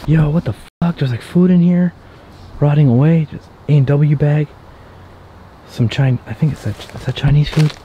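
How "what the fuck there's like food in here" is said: usual speaking voice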